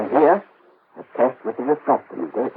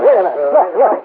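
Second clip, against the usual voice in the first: loud protest; 7 semitones higher, 9 dB louder than usual. Acted. Melchior protests over the shouting.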